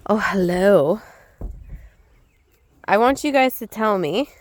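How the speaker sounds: ordinary talk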